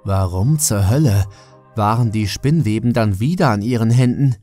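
ordinary delivery, nothing marked

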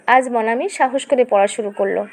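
usual speaking voice

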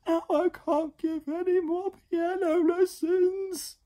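very high, slow, loud wail; hammed-up high lament; wailing piano teacher. A narrator's reading. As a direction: wail it out in despair. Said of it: "she cried from inside the mess".

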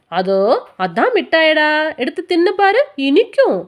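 high, sing-song tempting tone; sly old man coaxing a child with sweets. A narrator's reading. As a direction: lure the boy with sweetness. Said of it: "ஆசை மூட்டி".

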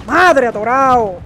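loud, slow drawn-out outburst; exasperated shout. Real, not acted. Player stuck in the game, shouting slowly and very loud. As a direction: bellow it out in disbelief.

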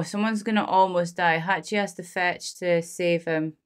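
ordinary talk

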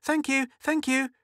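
put-on high eager little girl; cried out. Girl thanking her mother before dashing off, eager cry. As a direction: gush your thanks on the run. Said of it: "cried Mink".

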